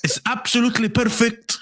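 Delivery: high-pitched mimicry of Mo's accent. Host mimicking Mo to sell jerseys, Mo accent. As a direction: keep up the put-on Mo accent. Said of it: "do it in the Mo accent".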